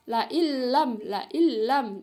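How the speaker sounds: LA IN LAM as "la illam", doubled l; merged idgham reading. Shown as a demonstration. Teacher repeating the merged letter for students, doubled l. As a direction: drop the n of IN into a held l. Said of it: "dimasukkan ke dalam huruf lam".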